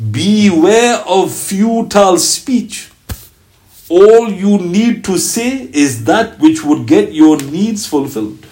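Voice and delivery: very slow, weighty reading of the saying; slow solemn quotation; solemn recitation. Acted. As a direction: let each word land slowly and gravely.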